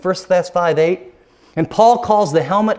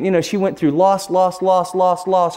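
normal talking voice

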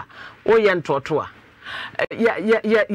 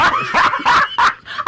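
burst of laughter; 14 semitones higher than usual. Real, not acted. Host laughs at caller's story.

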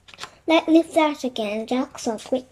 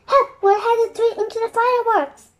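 high-pitched panicked call for help; raised, frightened storybook voice; alarmed cry; 10 semitones higher than usual. A narrator's reading. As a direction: cry out as danger rushes at you. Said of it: "cried Annie".

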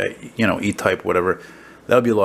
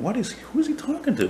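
lightly voiced puzzled wife, pitched up; imitating Gloria; 4 dB quieter than usual. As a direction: play Gloria wondering who he's talking to, high. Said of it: "Gloria's looking at me going".

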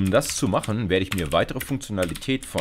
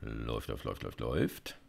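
soft murmur while waiting; quiet repeated murmur, watching a timer; 8 semitones lower, 11 dB quieter than usual. Real, not acted. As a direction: mutter it under your breath as the clock runs.

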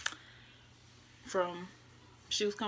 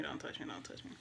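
hushed, broken whisper, much softer; whispered don't touch me; 4 semitones lower, 10 dB quieter, faster than usual. Retold or imitated. Grieving woman to boyfriend consoling her, whispered refusal. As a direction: repeat it fading to breath.

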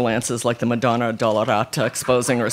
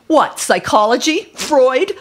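loud, high incredulous retort; shot back; 12 semitones higher, 6 dB louder, slower than usual. A narrator's reading. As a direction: fire back mockingly at the table. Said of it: "Christina shot back".

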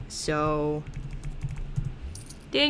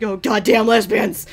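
put-on grumpy old man grumble; 3 semitones higher, 6 dB louder than usual. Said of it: "He's like".

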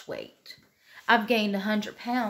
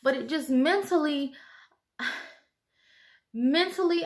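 tearful; 6 semitones higher than usual. Real, not acted. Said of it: "I didn't get on here to cry".

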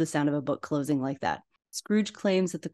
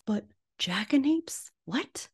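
whispered; 7 semitones higher, 3 dB quieter than usual. Real, not acted. Puzzled by an odd word.

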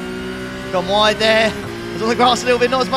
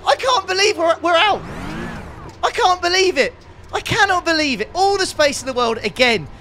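raging at being taken out; angry rant; 11 semitones higher than usual. Real, not acted. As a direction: vent real fury at the crash. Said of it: "I'm so angry".

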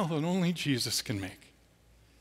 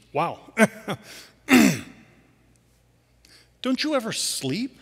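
mock amazement; 7 semitones higher, 7 dB louder than usual. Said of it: "him at some point going".

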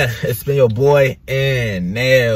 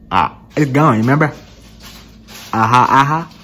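lip pulled down open mouth; finger in mouth. Real, not acted. Seen 5: Finger hooks cheek, then lower lip, with mouth wide open.

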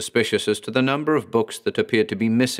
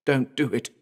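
begging; 4 semitones higher than usual. A narrator's reading.

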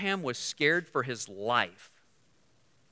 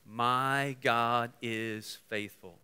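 slow declaration; 4 semitones lower, 4 dB quieter, much slower than usual. Shown as a demonstration. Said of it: "Say that with me".

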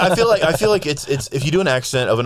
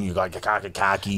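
mock Boston accent; 3 semitones lower, 5 dB quieter than usual. Shown as a demonstration. Listing regional accents, mock Boston accent. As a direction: drop the r's like a Bostonian. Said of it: "a Brooklyn or a Boston".

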